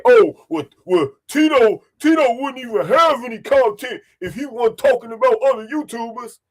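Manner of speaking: mock-whiny impression of online critics; mocking detractors. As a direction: parrot the haters in a whiny put-on voice. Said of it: "detractors that'll say".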